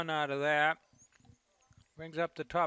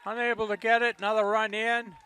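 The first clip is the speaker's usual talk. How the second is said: excited; 5 semitones higher, 8 dB louder than usual. Real, not acted. Play-by-play, run scores.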